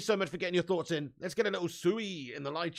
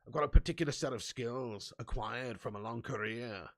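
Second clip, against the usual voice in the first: lower, menacing Northern Irish Liam Neeson voice; 8 semitones lower, 4 dB quieter than usual. Retold or imitated. Ten Hag on the phone threatening Barcelona, lower Northern Irish Liam Neeson voice. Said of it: "it's a terrible Northern Irish accent".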